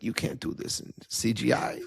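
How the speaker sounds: a deliberately bad German accent, breathy and near-whispered; Herzog's German accent, half-whispered and hammed-up. Podcaster imitating Werner Herzog's German accent to his co-host. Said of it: "This is horrible s-, German accent".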